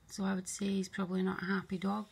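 usual speaking voice

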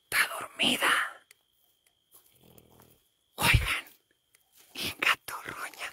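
hushed whisper so she won't wake; unvoiced whisper over a sleeping friend. Real, not acted. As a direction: breathe the words without voice.

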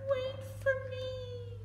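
high, slow little frog call. A narrator's reading. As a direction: call after the princess in a tiny high frog voice. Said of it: "the frog yelled after her".

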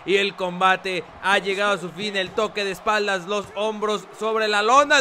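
loud ringside call; wrestling commentator. Acted. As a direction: call the pinfall. Calling the pinfall.

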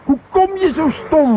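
high, loud, slow furious reproach; exasperated angry snap; angry outburst. Acted. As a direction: tear into him for his stupidity. Scolding the driver angrily.

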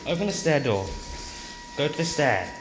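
ordinary delivery

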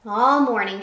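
ordinary delivery, nothing marked

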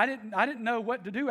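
ordinary talk, nothing marked

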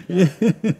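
high laugh into a laughing yeah. Real, not acted. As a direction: laugh at your own joke. Laughs at own joke.

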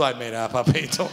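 low, laughing put-down voice; mock knowing adult. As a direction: tease him like a jaded elder. Imita a un adulto burlón. (burla grave entre risas)

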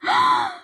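high, sharp gasp of delight; shocked gasp. Real, not acted. Fan sees a new card, high shocked gasp. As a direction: gasp high in sudden amazement.

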